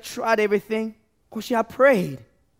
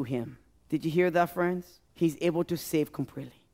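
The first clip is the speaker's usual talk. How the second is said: hushed preaching; 5 semitones lower, 6 dB quieter than usual. Acted. Preacher pleading softly with the congregation, low and quiet. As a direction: lower and much softer, intimate sermon voice. Preacher drops to intimate appeal.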